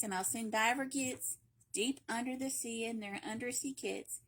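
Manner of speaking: ordinary delivery, nothing marked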